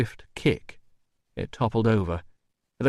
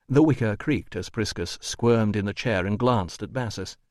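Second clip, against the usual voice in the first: ordinary delivery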